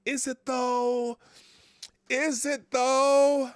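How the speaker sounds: high, long drawn-out doubting question; mock sceptical. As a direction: stretch it out high to question the claim. Mock doubt at a quoted reply.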